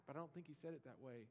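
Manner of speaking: normal talking voice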